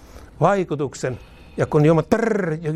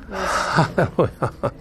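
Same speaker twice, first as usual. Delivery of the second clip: laughing halleluja; much slower than usual. Real, not acted. Laughing praise.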